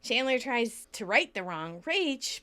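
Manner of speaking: ordinary talk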